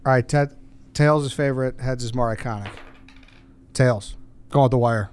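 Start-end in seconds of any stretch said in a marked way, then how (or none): none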